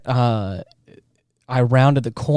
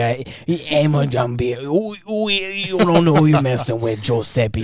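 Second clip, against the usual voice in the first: goofy fake Italian mob accent; Italian mob boss accent. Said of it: "pseudo Italian accent or something".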